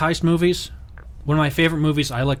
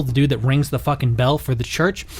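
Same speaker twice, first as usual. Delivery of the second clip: ordinary delivery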